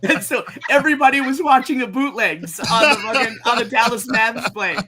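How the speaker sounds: high, loud, amused through laughter; laughing. Real, not acted. Telling the hosts his bootleg punchline, laughing. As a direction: crack up while delivering the punchline.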